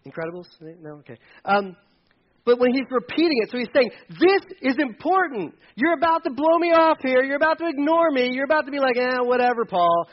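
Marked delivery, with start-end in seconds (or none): 4.1-10.1 higher, louder pleading as Paul; voicing Paul urging. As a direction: lift the voice, press the listener to pay attention. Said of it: "And Paul's going".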